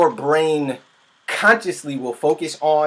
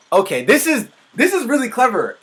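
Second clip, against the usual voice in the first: high-pitched, eager praise; excited; 8 semitones higher than usual. Real, not acted. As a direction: gush with real excitement, voice jumping up. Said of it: "I'm excited".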